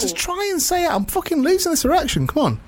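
high, pleading frustration; mock frustrated urging; high frustrated plea. Impatient caller role-play.

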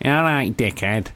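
nasal Manchester greeting; mock Manchester twang, falling at the end; Manchester accent. Shown as a demonstration. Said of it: "Real nasal, innit?".

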